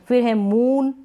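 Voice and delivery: ordinary delivery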